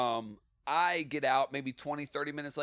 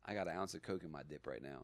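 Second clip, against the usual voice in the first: confiding; 10 semitones lower, 12 dB quieter than usual. Retold or imitated. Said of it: "He goes".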